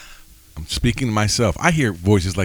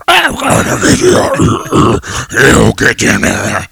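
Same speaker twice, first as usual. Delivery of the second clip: mock villain shout; 3 semitones higher, 9 dB louder, slower than usual. Mocking how the enemy's voice would not sound, big slow shout. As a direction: ham up a cartoon enemy yelling. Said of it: "the voice usually doesn't go like".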